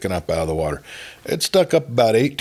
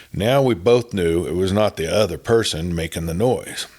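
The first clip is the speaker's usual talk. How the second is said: plain reading voice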